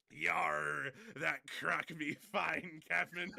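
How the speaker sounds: loud, slow growling pirate. Pretend. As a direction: arr it out like a pirate captain. Pirate joke, Captain Crack Sparrow.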